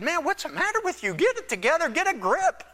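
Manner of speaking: exasperated Pilate. Retold or imitated. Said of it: "he said".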